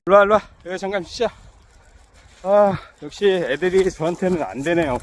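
usual speaking voice

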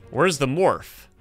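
ordinary talk

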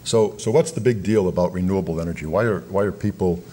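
ordinary delivery

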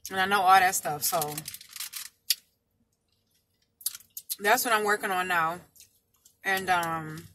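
eating between words; talking while chewing. Real, not acted. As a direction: mouth partly full of food. Seen 0: picks taco bits, hand to mouth at 1.3–1.6s, keeps eating.

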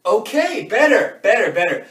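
loud, rapid-fire encouragement; booming coach-like praise; fired-up approval. Acted. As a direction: cheer on the improvement, loudly. Reacting to a keener team member.

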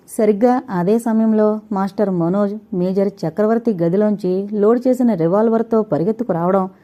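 ordinary delivery

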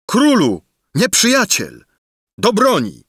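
high, loud messenger's call to arms; alarmed shout. A narrator's reading. Messenger bursts into the ballroom, loud high alarm. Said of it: "wołając".

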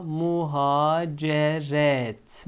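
slow drawn-out "muhaceret"; careful slow "muhaceret"; slow model reading. Shown as a demonstration. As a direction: stretch each syllable for the class. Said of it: "son iki kelimemiz muha".

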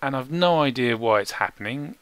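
ordinary talk

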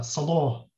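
"salo" ending on a long o, no T; Hijazi o vowel. Shown as a demonstration. Quoting the grammarian's rule, "salo" with long o. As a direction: say "salo" with o replacing the final a. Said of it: "these words should be pronounced as".

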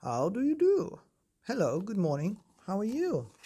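lightly voiced toad, a cheery high greeting; a giant toad greets the boy politely, high and friendly. A narrator's reading. Toad greeting the boy at the pond, lightly voiced cheery hello. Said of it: "He smiled and said".

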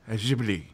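Ghibli with a soft French-style J, "zhib-lee"; offered pronunciation. Shown as a demonstration. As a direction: say Ghibli starting like the s in measure. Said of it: "I believe it's pronounced".

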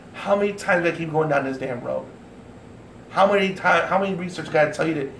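usual speaking voice

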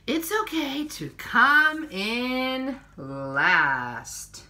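slow, gentle picture-book reading; reading to children. Acted. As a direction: read each page to little ones, unhurried.

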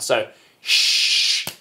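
long unvoiced shushing hiss; sh sound. Shown as a demonstration. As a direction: push out a steady "sh". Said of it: "make a sh sound".

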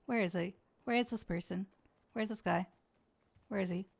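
low, soft muttering; hushed searching. Real, not acted. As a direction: mutter to yourself while scanning. Searching for hidden enemy.

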